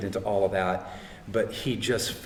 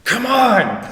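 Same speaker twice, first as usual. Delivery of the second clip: loud, high cry of appeal; retold exasperated shout; 11 semitones higher, 9 dB louder than usual. Son lifting his head to God, acted pleading shout. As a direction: shout it upward, acting out the plea. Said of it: "I lift my heads up and I'm like".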